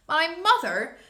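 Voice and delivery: My mother? cried out high and loud; startled outburst; amazed giant crying out; surprised cry. Acted. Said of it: "cried the B F G".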